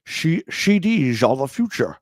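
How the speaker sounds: put-on Scottish Sean Connery accent, every s slurred to sh; "C Ds" as "shee deezh", Sean Connery voice; Sean Connery impression. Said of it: "You need to do that in a Sean Connery accent".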